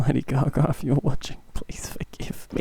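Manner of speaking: breathless whisper, slowed; whispered plea. Real, not acted. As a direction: whisper an apology. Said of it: "whispered plea to [name]".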